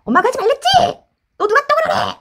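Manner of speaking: high, loud mock scolding; angry scolding mom. Parent imitating a mother yelling back at her child. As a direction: snap at the kid like an angry mother. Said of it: "이런 거".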